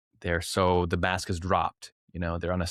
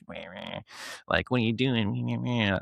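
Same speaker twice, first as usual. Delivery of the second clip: put-on childish taunt; 2 semitones higher, 3 dB quieter than usual. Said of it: "that sort of vibe".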